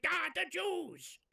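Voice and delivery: slow, high hammed-up mock wail; exaggerated mock cry; mock ayatollah. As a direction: imitate the ayatollah's complaint. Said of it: "and he's like".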